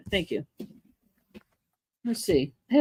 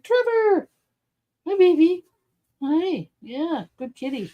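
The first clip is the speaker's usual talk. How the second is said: high cooing cat talk; talking to a baby; 8 semitones higher, slower than usual. Retold or imitated. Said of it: "Patrick's like".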